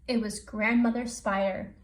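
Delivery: usual speaking voice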